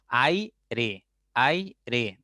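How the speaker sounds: "aire" slowly as ai, re; syllable by syllable. Shown as a demonstration. Teacher separating syllables, "aire" in two slow beats. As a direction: keep ai as one beat in "aire", then re. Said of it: "entonces tendré".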